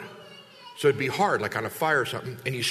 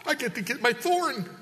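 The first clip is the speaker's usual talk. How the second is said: Satan crowing over getting Paul, voice pitched way up and louder; Satan gloating; 3 dB louder than usual. Retold or imitated. Preacher voicing Satan eager to torment Paul, high gleeful gloat. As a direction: play a gleeful, high-voiced Satan who can't wait to get his hands on Paul. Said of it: "and Satan".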